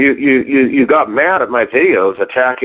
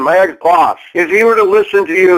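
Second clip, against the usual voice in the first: usual speaking voice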